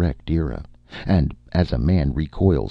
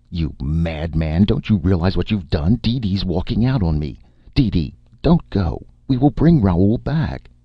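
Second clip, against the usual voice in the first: outrage rising into high, loud pleading; snapping, then pleading; 5 semitones higher, 4 dB louder than usual. A narrator's reading. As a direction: scold him, then beg her with climbing pitch. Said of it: "he snapped".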